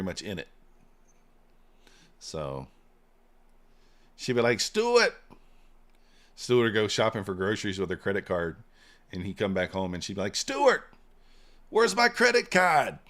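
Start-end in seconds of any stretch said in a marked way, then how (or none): 4.6-5.1 high, loud Boston mom calling out; awful Boston accent. Retold or imitated. Mom calling her son, high hammed-up Boston accent. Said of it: "That's my awful, uh, Boston accent". 10.4-13.0 loud, high-pitched Boston mom demand; hammed-up Boston mother yelling for her son, drawn out; awful Boston accent. Mom demanding her credit card back, mock Boston yell. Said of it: "That's my awful, uh, Boston accent".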